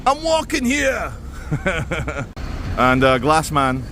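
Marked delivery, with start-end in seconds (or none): 0.1-1.1 high, slow street holler; playful, drawn-out high call; mock-indignant catchphrase. Replays the catchphrase, then laughs. 1.2-2.7 real chuckle at own joke; short burst of laughter; amused laugh. Real, not acted.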